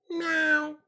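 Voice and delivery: high kitty mew at the door; cute cat meow. Pretend. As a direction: mimic a cat asking to be let in. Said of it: "Zach the cat outside".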